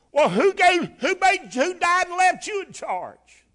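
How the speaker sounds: high, loud mock indignation, slowing on the last line; sassy local comeback. Townsfolk challenging Moses, loud indignant Depew vernacular. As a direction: fire it back like a fed-up townsman. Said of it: "That's Depew vernacular".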